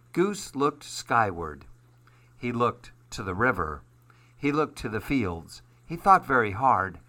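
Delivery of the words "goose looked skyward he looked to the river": ordinary delivery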